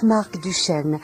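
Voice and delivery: normal talking voice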